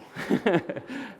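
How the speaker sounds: high bright laugh at his own joke; real amused laugh. Real, not acted. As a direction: burst out laughing.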